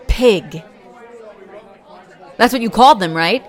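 loud, furious calling out; angry confrontation. Acted. Confronting the bully who insulted the partner, loud and angry. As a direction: get in his face, loud and sharp.

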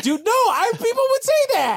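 squeaky indignant dude; defensive dude voice. Retold or imitated.

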